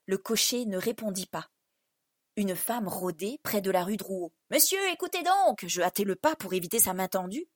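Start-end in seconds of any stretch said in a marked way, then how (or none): 4.5-5.6 higher-pitched street woman's call; lightly acted call to a stranger. A narrator's reading. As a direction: hail a passer-by in a raised-pitch voice. Woman calling out to the passing narrator.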